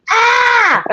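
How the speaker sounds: sudden high yelp as the foot slips; startled yelp. Real, not acted. Foot slips while sitting on floor.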